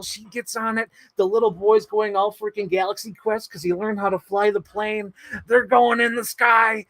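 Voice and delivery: high, breathless-fan rush of events; excited retelling. Retold or imitated. Fan reliving the movie's time-travel climax, high excited disbelief. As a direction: build the amazement as you recount it.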